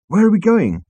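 high, loud urgent question; alarmed. Acted. Trillian to the crew on a careering ship, alarmed. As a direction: call it out in alarm.

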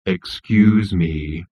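very slow, drawn-out gloom; miserable robot. Acted. Marvin the robot interrupts the insect, utterly mournful. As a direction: drag it out mournfully. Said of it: "a voice that would have made an insect".

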